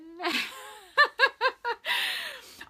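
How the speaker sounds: real high-pitched giggling laugh. Real, not acted. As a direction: burst into a tickled giggle. Joking about unicorns she can have.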